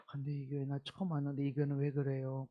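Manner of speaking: a recruiter playing the new believer, hushed; hushed, sly newcomer. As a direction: fish for complaints under your breath. Newcomer act by cult member.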